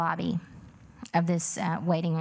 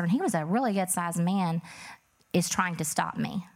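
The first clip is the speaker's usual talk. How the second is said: ordinary delivery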